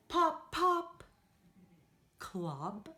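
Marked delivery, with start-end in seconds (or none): 0.1-1.0 high, loud popping. Shown as a demonstration. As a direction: pop it out high. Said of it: "You follow Miss Debbie".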